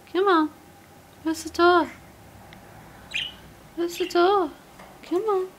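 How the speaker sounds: high, sing-song coaxing to a bird; pet talk voice. Real, not acted. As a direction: coax a shy budgie out.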